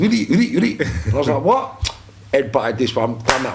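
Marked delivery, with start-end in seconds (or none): 0.0-0.8 high, rapid mock Scottish babble; Scottish accent. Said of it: "when in this Scottish accent". 1.4-1.9 high, incredulous one-word reply; baffled. Retold or imitated. Acted baffled reply to the Scot.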